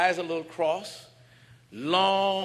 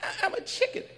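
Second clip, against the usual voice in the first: high breathy timid bird; squeaky whispered bird; much faster than usual. Retold or imitated. The bird whispering back its refusal. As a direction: shrink away, whispering.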